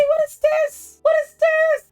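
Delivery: very high-pitched frantic squeal; mock shriek. Grabbing at the devil by night, high mock shriek. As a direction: shriek the question twice in mock alarm. Mother grabs him, panicked questioning.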